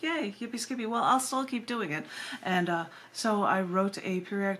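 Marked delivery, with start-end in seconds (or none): 0.0-2.1 a higher, lightly voiced retold hooray; playful mock celebration, then a shrug; half-joking yippee, tossed off; mock cheer. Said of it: "So I was like".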